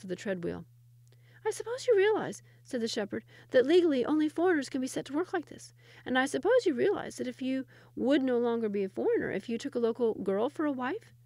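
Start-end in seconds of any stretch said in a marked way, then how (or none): 1.5-11.1 put-on high, knowing boyish voice; knowing shepherd boy. Shepherd boy lectures the Sultan, high and knowing. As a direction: explain it like a smug boy.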